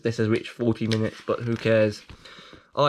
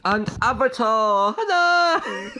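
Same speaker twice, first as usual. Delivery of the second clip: excited hello; 10 semitones higher, 4 dB louder, slower than usual. Pretend. Joke greeting to character.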